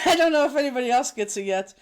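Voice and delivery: loud, laughing while talking. Real, not acted. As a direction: laugh through the line. Laughing fit over lip trick.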